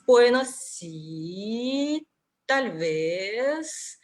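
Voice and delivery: "bueno, sí, tal vez" slow, dipping then rising; hesitant, drawn-out dip and rise on "sí" and "vez"; doubtful dipping tone. Shown as a demonstration. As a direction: stretch "sí" and "vez" doubtfully, pitch swooping up. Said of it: "cuando tenemos dudas".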